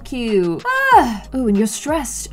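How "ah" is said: sudden high-pitched "ah"; brief high exclamation; short high cry. Real, not acted. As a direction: let out a quick, high yelp. Outburst while playing the game.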